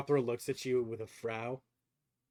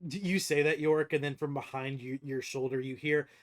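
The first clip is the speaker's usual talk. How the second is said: usual speaking voice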